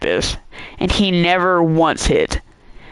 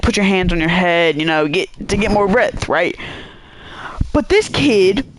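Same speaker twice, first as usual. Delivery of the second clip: ordinary talk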